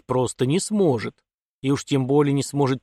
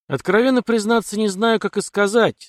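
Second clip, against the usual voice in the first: pensive; 8 semitones higher, 4 dB louder than usual. A narrator's reading. Colonel answering his daughter about officers, pensive. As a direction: think aloud at a raised pitch, unsure how to put it. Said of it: "задумчиво протянул полковник".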